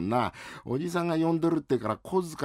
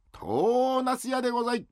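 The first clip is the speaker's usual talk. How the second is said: vendor's call. Acted. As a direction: sing out like a peddler. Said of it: "あ、うめえな。". (行商人の呼び声)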